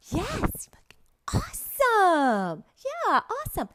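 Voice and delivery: high, thrilled exclamations; excited praise. Shown as a demonstration. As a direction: gush over a child's answer. Acts student answer and teacher praise.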